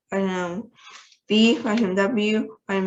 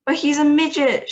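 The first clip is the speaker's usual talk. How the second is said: a loud, higher narrated shout; 7 semitones higher, 6 dB louder than usual. A narrator's reading. Father shouting in dismay about his shrunken son, loud higher shout. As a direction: bark it out in dismay. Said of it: "shouted Mister T V".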